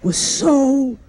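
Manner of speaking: slow, halting, tearful eulogy voice with catches between words; Howard Stern choking back tears. Retold or imitated. Howard Stern at Joan Rivers' funeral, choking back tears. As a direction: do a low, choked-up Howard Stern fighting back sobs. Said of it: "he's choking back tears".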